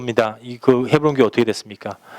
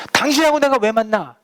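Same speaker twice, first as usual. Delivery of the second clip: high-pitched indignant retort, retold; imitated scolding; 8 semitones higher than usual. Senior pastor refusing a request to meet, imitated scolding. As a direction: mimic an angry refusal to meet. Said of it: "막 이러면서 혼내시더라고요".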